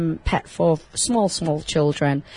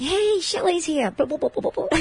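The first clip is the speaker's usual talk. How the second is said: excited guinea pig; 9 semitones higher, 4 dB quieter than usual. Acted. Host voicing guinea pigs greeting their owner, high excited babble. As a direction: cheer softly up high, then bubble away. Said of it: "they're like".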